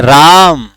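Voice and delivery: राम with the आ held long and loud; long, ringing आ in राम; drawn-out vowel. Shown as a demonstration. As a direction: draw out the आ of राम before closing on म. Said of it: "लंबा खींचा है".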